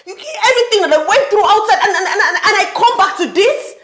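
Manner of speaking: loud, high exasperated rant; yelling. Acted. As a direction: rant at full voice, stammering with outrage. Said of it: "I'm sorry I yelled at you".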